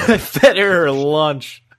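hammed-up boss bragging; mock boss voice. Retelling the boss's brag to his cohost, mock voice. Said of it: "He's like".

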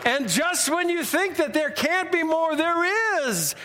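showman's drawn-out prize reveals; big, hyped host delivery with stretched words; game-show announcer voice. Acted. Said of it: "in my best Jay voice".

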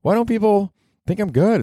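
mock bewildered whine; clueless whiner. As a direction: whine like an oblivious hack. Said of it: "they're just like".

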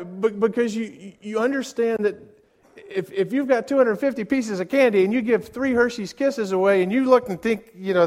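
ordinary talk, nothing marked

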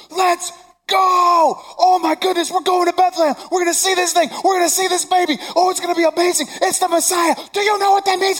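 put-on high, loud, thrilled kid voice; excited boy. Shown as a demonstration. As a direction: shout it like a twelve-year-old who can't contain himself. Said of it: "In only eleven, twelve-year-old boy fashion".

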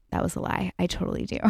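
soft confessing whisper; sheepish whispered admission; whispered aside. Real, not acted. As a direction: let them in on a secret.

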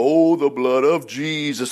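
slow, low pious intoning; acted reverence. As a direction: draw it out with solemn, put-on devotion. Acts out pious exclamation.

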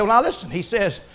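low, soft answer; Jesus calmly answering the critics; hushed calm reply. Retold or imitated. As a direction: answer them quietly with authority. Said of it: "He said".

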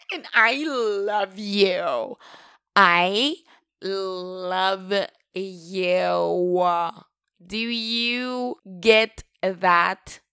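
loud, drawn-out proclamation; over-the-top declaration. Real, not acted. Host declaring love to unknown listeners, loud and theatrical. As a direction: belt out each word, stretching it for effect.

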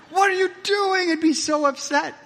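high, loud upset outburst; upset cry. Retold or imitated. In his nightmare crying out at Jesus, high and loud.

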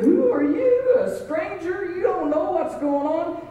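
very high, mock-astonished questioning; incredulous challenge. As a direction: burst out in disbelief at a stranger. Travelers challenge the stranger, incredulous.

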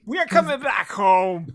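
loud, punched rallying cry; fired-up shout. Real, not acted. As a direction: shout it to the home crowd. Rallying cry, repeated louder.

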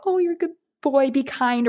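high-pitched mock-mom voice; put-on motherly coaxing, high; imitated mother. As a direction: pitch it up like a mother praising her little boy. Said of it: "my mom was, like, saying to me".

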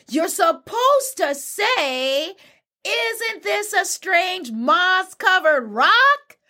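high, slow, over-spelled-out line; exasperated. Acted. Anansi tells Bush Deer what to say, slow exasperation. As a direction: drag each word out in acted frustration.

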